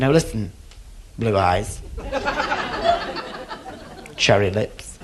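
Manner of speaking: a hint of a camp fella flirting with the barman. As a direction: coo at the barman in a mock camp voice. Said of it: "I'm only acting when I do this".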